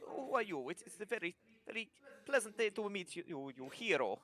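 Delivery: lightly voiced kobold with a hint of an Italian accent; eager little trader, thankful and fussy; grateful kobold merchant. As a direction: kobold in a put-on Italian lilt.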